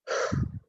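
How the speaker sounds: wordless releasing exhale; grounding sigh. Shown as a demonstration. Open emotional center letting others' feelings drain, audible sigh. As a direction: let out a demonstrative grounding breath.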